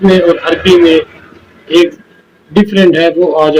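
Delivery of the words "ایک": normal talking voice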